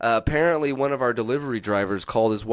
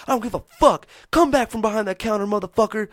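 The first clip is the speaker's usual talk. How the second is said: furious rant; 10 semitones higher than usual. Retold or imitated. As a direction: shout it like the enraged dog trainer. Retold trainer's challenge.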